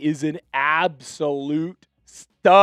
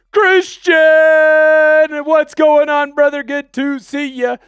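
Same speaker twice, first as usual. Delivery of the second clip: loud, high, drawn-out greeting; host hyping up a viewer on screen; hype greeting; 9 semitones higher, 7 dB louder than usual. Real, not acted.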